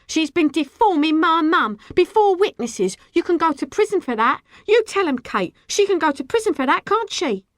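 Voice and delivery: high, loud gleeful threat; excited, accusing clamour; triumphant shouting. A narrator's reading. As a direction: crow it to the onlookers. Girl gleefully threatens before crowd.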